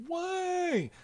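put-on high, squeaky little kid asking why; whiny toddler. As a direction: mimic a toddler who won't accept no. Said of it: "if you've ever been around a toddler".

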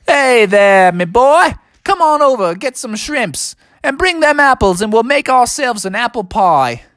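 high, hammed-up Aussie kangaroo; Australian accent. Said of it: "your Australian accent sure is awesome".

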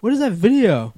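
high, loud startled voice; hammed-up flustered partner; caught off guard. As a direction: act shocked at being walked in on. Acting out caught-in-the-act skit.

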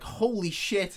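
high, amazed outburst; mock amazement. Fan convinced it is the guitarist, mock amazement. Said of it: "he's like".

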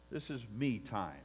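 usual speaking voice

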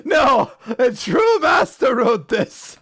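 slow, high, proclaiming tone; hammed-up pompous defense; mock grandeur. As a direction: declare it like a proud herald.

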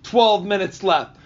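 loud, high ranting countdown, imitated; retold stand-up tirade, loud and high; Bill Burr. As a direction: bellow it like a comic berating the crowd. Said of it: "And he's like".

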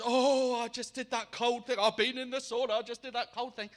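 lightly hammed-up breathless chatter; excited babbling. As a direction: imitate someone buzzing after a cold plunge. Retelling the excited woman's babble.

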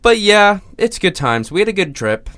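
forced cheer. Real, not acted. Ironic cheer after failed trip.

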